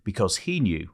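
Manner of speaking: measured reading voice, low and even; memoir reading. Acted. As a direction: read it like an audiobook passage. Reads memoir aloud over music.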